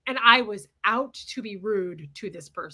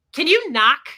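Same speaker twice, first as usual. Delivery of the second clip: rude; 5 semitones higher, 10 dB louder than usual. Retold or imitated. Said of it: "I was so rude to her".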